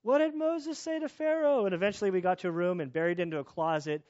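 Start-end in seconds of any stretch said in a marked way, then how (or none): 0.0-1.7 much higher, louder sing-song calling. Retold or imitated. Parents calling the question through the house, much higher and louder. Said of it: "So we're going around the house".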